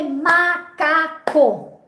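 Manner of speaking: "macaco" slowly as ma-ca-co; clap-along pace, "macaco" split in three; syllable by syllable. Shown as a demonstration. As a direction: give each syllable of "macaco" its own long beat. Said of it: "contar quantos pedacinhos".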